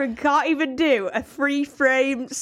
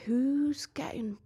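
whispered thought; 5 dB quieter than usual. Retold or imitated.

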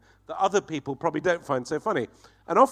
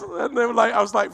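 usual speaking voice

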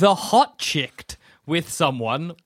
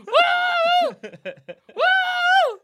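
dog loose in a human body, wild whoop; excited whoop. Pretend.

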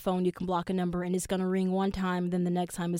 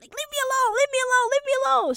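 imitating a shrill, much higher cry of leave me alone; mock high yell; 4 dB louder, faster than usual. The upset date yelling at her in Hell's Kitchen, mock high yell. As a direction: pitch it way up like her crying date. Crying girl yelling in street.

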